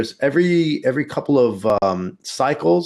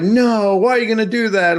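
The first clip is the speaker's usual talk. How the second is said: mock outraged people; 8 semitones higher, 3 dB louder than usual. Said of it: "some people are like".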